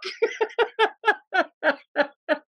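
high, bursting laugh; big laugh. Real, not acted. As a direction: crack up at the punchline. Reacts to his own punchline.

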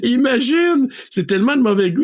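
high, disbelieving exclaim; incredulous. Real, not acted. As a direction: sound appalled and amused. Outraged at the tasteless restaurant.

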